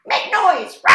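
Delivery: high, loud parrot shout. A narrator's reading. Parrot urging the creatures to make noise, loud and high. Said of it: "he shouted".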